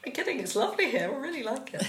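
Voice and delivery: lightly incredulous, voice lifted in pitch; retold incredulity. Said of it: "It's like".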